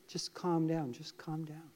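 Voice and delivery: soft breathy hush to calm a listener. Acted. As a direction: soothe like a hushed voice. Lord calling, soothing.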